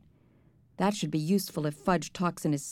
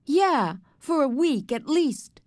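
high, louder emphatic reply; emphatic retort; 6 semitones higher, 4 dB louder, slower than usual. A narrator's reading. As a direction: insist on it, pitched up. Argument over the coming visitor.

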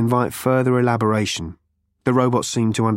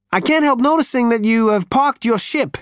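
higher, character voice for the alien; 11 semitones higher than usual. A narrator's reading. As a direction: lift into the alien's voice. Character voice in read story.

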